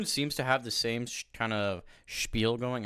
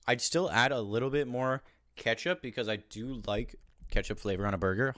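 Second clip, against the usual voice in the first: ordinary talk, nothing marked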